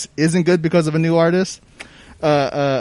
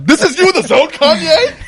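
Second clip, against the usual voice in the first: high laughing jab; laughing tease; 11 semitones higher, 4 dB louder than usual. Real, not acted. Friend teasing [name] fans, laughing high. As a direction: tease him through a laugh.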